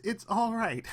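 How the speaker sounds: mocking the guy who says it's fine, high and loud; mock cheerful voice. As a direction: mimic someone brushing it off, high and bright. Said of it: "he says".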